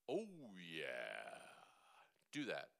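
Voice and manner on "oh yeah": low sliding oh yeah; low oh yeah demo. Shown as a demonstration. Voice coach showing a student the low zip, low. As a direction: drop into the bottom of the voice. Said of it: "I put my hand on my throat like this and I go".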